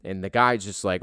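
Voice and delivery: ordinary delivery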